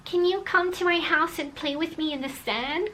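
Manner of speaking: a hint of a five-year-old asking to play; lightly voiced little boy. Little boy asking her to play in the sand, lightly voiced.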